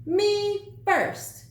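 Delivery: high, loud pushy cry; greedy little "Me first"; cried out. A narrator's reading. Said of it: "he cried".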